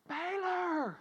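mock holler without the volume; held-back holler. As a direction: call the dog, holding the volume back. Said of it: "So I holler".

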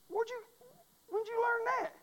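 high, startled question; surprised. Retold or imitated. Preacher retells his surprise.

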